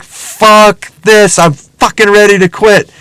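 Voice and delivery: re-enacted burnt-out frustration, hard emphasis; fed-up outburst. Worker months into the hustle, acting out fed-up exasperation. Acts out burnout after months.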